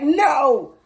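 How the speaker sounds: put-on high, loud childish protest; shouted no. Kid screaming back at mom with the broom, high shout. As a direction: yell it out like a kid refusing. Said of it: "And I'm like".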